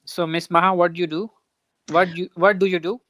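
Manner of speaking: normal talking voice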